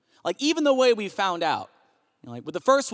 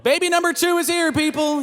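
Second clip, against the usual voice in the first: high, bright proud announcement; excited announcement; 10 semitones higher than usual. Real, not acted. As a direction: announce it like big news.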